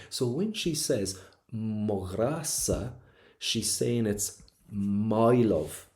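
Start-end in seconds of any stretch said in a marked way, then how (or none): 1.5-2.9 "Magrasa" said very slowly, every sound stretched; possessive emphasis. Acted. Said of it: "she's putting an emphasis". 4.1-5.9 "my" stretched long and leaned on; possessive emphasis. Acted. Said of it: "she's putting an emphasis".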